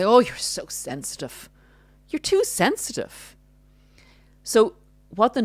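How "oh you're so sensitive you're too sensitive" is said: rising to a much higher scoffing jab; mock their sensitivity, scoffing; mock narcissist. Narcissist brushing off partner's objection, scoffing higher mock. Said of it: "And they say".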